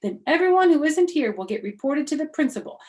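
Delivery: high, loud, fast tattletale; gloating May. Acted. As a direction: relish the punishment.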